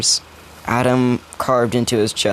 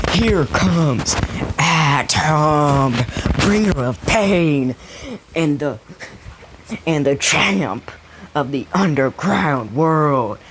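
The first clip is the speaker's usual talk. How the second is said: higher, slow, drawn-out ring announcer build-up; hyped underground announcer; 4 semitones higher, slower than usual. Retold or imitated. Finn introduces Deku at the final fight, higher and slow in a ring announcer voice. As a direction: sell the champ's entrance like a fight-night MC. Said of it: "His true underground commenting comes in".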